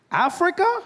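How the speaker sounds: high-pitched baffled question; incredulous echo. Retold or imitated. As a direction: squeal it in disbelief. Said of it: "He's like".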